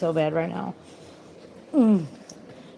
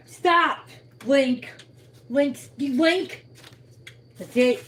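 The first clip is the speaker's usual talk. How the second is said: scolding shout; 9 semitones higher, slower than usual. Real, not acted. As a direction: yell at the dog to stop. Scolding pets, raised loud voice.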